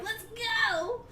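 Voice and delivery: very high-pitched squeal; excited cheer. Acted. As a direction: cheer like a thrilled kid, played up. Acting excitement at the carnival.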